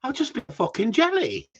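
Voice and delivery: normal talking voice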